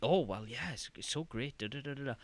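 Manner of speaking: mock earnest. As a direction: parody an over-earnest game voice line. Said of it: "earnestly and very".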